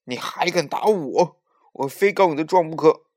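loud, high outraged shout; beside yourself with rage; furious. A narrator's reading. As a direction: roar it indignantly, drawing out the challenge. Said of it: "龙王气得发昏".

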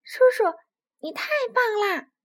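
high, loud delighted cheer; happy shout. A narrator's reading. Little dinosaur praising uncle for the red fruit, joyful shout. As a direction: cry it out in childish joy. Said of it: "臭臭哒哒高兴地喊道".

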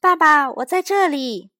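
put-on high, loud child calling out; boy calling to his father. A narrator's reading. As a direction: shout for dad to notice. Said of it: "大声喊道".

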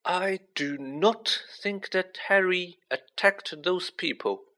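put-on slow, high, deliberate old wizard; patient, measured correction in a lifted voice; measured headmaster. A narrator's reading. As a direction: state it slowly and deliberately. Dumbledore calmly corrects ranting Hagrid.

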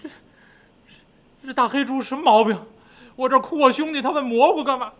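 weepy demon king, put out; crying Golden Horn King. A narrator's reading. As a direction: complain through mock sobs. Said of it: "一边哭一边的".

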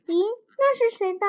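high, quick, puzzled exclamation; surprised question. A narrator's reading. Children spotting an unknown heart on the tree, surprised. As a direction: wonder aloud, pitch jumping up.

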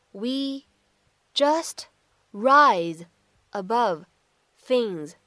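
slow, each word set apart with pauses; careful dictation-style reading. Shown as a demonstration. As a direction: read it word by word for students to copy. Teacher reads line word by word.

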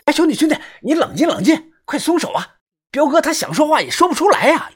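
高声急劝; 拉架时慌张地高喊; 王超大喊劝阻. A narrator's reading. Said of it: "大声的喊道". (王超慌张地大声劝阻)

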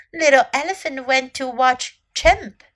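plain reading voice

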